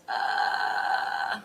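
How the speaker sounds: long, soft creaky "uh"; vocal fry noise. Shown as a demonstration. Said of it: "making this noise".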